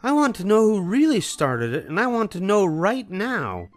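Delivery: high, loud demanding shout; angry demand. A narrator's reading. Mama demanding who started it, angry. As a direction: insist on an answer now. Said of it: "she shouted".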